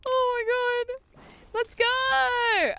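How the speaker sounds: excited cheer. Real, not acted. Celebrating in game.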